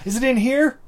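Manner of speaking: high, loud hunting question; hammed-up harried search; mock frantic searcher. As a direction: play a flustered cop rummaging. Acted cop searching for devices.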